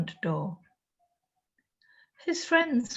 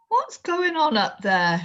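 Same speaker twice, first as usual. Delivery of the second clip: loud, raised call from below; father shouting up at the roof; shouted; 7 semitones higher, 5 dB louder than usual. A narrator's reading. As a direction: call up through the roof, alarmed. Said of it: "shouted Anna's father".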